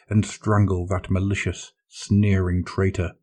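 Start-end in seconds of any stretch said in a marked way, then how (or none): none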